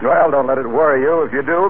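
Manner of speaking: ordinary delivery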